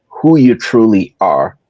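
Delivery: ordinary talk, nothing marked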